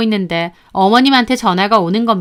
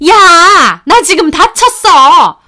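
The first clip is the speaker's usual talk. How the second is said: high, loud, slow shout of pain; mother-in-law shouting; 7 semitones higher, 8 dB louder, much slower than usual. Retold or imitated. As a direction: shout that she got hurt. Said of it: "하고 소리를 지르시는 겁니다". (다쳤다고 며느리에게 소리치는 시어머니)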